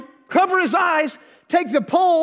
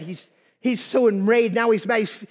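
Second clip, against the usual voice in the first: ordinary delivery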